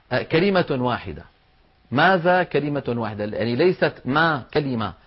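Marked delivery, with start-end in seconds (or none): none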